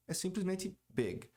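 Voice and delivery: usual speaking voice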